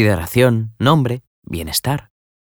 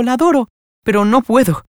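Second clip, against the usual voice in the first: higher pleading cry on no puedo. A narrator's reading. Nela begging Florentina to understand, high plea. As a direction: beg her to understand, voice climbing. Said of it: "murmuró Nela".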